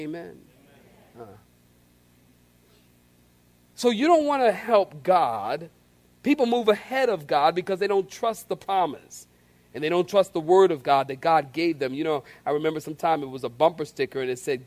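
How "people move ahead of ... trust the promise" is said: ordinary delivery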